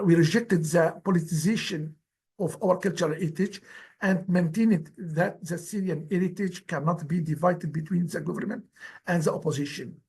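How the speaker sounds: ordinary talk, nothing marked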